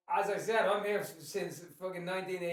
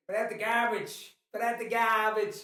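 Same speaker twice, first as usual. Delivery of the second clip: louder, slower put-on American twang; 3 semitones higher, 6 dB louder than usual. Irish dad showing what they say over here, mock American twang. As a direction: lay on an American twang, drawn out. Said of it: "pick up on some of the American twang".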